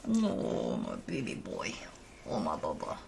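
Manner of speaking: very low, soft pet talk; hushed, low doting murmur; cooing to dog. Real, not acted. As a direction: coo tenderly to a beloved dog.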